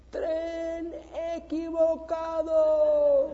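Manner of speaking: high, drawn-out call from far away; distant shout of wrong train. Retold or imitated. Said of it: "una voz muy lejana".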